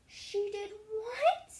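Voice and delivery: high, loud, slow shocked cry; the king can't believe his ears; king cries out. A narrator's reading. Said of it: "cried the king".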